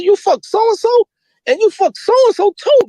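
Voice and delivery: high, slow, listing his sins; high slow retold scolding; slow incredulous scolding. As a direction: spell out each offense in disbelief. Retelling scolding Poe.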